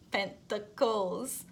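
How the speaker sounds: Pentacles drawn out slowly through a laugh; careful Pentacles, laughing. Shown as a demonstration. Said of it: "I will have to say it like this every single time".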